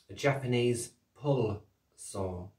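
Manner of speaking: slow, careful "Japanese pull saw", every word spaced out; said slowly. Shown as a demonstration. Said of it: "I say that slowly 'cause of my accent".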